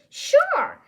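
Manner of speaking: high, loud chirp; perky, high-pitched yes; eager agreement. Acted. Lucky eagerly agrees to help.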